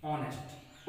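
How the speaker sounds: ऑनेस्ट with a silent h, starting straight on the open O; ऑनेस्ट spoken slowly and clearly, vowel first, for the class; careful pronunciation demo. Shown as a demonstration. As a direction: say ऑनेस्ट as "onest", no h sound at all. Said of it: "ये बोला मैंने".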